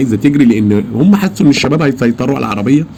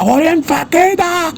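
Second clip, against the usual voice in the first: much higher, slow, drawn-out outraged cry; retold indignant yell; much slower than usual. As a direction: stretch it out, shocked that this is allowed. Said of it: "لقيت نفسي بزعق".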